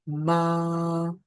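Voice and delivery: मा with the आ drawn out long; stretched syllable. Shown as a demonstration. Teacher showing how meem with alif is stretched. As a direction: hold the vowel in मा. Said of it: "अगर मैं मीम को लगा देता हूं".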